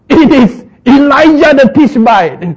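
high, loud realising cry; alarmed exclamation. Acted. King recognises prophet.

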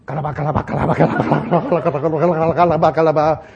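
rapid-fire looping syllable chant; three-syllable tongues. Shown as a demonstration. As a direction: rattle "kalaba" on one level pitch. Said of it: "Pray in tongues with three syllable".

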